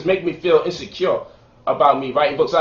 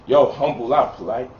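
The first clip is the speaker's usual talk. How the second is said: lower, softer mock "humble out"; mocking scold; 8 semitones lower, 4 dB quieter than usual. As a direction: sneer like a preachy critic. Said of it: "But you're not supposed to say".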